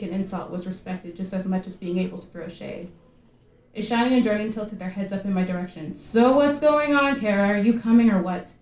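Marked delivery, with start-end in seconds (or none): 6.0-8.5 pressure a friend, loud and stretched out; higher, louder peer-pressure voice; impatient goading. A narrator's reading. Friends goad Kara to come along.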